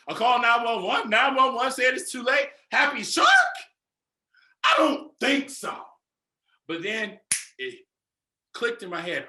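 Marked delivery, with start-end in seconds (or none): 0.1-5.7 rising higher and louder into a mock shout; building mock rant. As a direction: work yourself up into an outraged yell. Retold rant at the lady.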